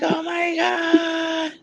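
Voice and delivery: high, slow, drawn-out shriek of joy; excited squeal. Retold or imitated. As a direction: stretch it out like you've spotted an old friend. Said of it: "She was like".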